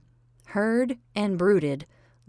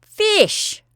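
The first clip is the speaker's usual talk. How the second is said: loud, much higher snap; curt cook; 9 dB louder than usual. Acted. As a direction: bark the single word with scorn. Cook answers with a sniff.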